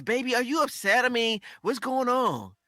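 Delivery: put-on high, whiny "Baby, are you upset?". Shown as a demonstration. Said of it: "it's all in the tone".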